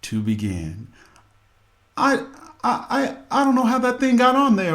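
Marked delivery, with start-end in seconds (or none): 2.0-4.8 high, fast, loud flustered excuse; husband caught by his wife, stammering a high, fast excuse; stammering liar. Retold or imitated. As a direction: stumble over the lie in a high panic. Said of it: "the multitude of lies to begin".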